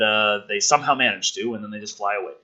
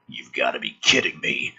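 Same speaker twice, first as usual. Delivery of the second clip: stunned soldier voice; 13 semitones lower, 5 dB quieter than usual. Acted. Character line in dramatic reading.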